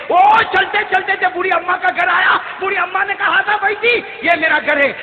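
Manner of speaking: high, forceful preacher's declamation; impassioned preaching. Acted. Preacher retelling an old woman's blessings behind the Prophet, impassioned.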